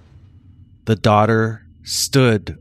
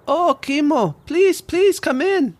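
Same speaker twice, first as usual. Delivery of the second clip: put-on high sweet old lady voice; much higher, welcoming elderly woman; Jan. Frank's wife Jan speaks.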